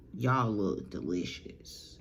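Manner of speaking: low, slow, menacing giant voice; creepy Titan. Acted. Grinning Titan to the trapped family, low and slow. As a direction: savor each word like a hungry monster. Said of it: "She was like".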